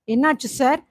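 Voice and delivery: plain reading voice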